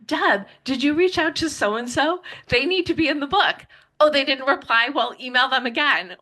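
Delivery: high, eager mom nudging her daughter; voicing her mom. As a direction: play her mother pushing her to chase contacts. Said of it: "whether it was like".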